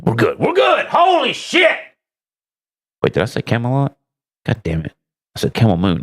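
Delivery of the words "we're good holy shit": startled shout. Real, not acted. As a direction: jump and shout in surprise. Startled mid-sign-off.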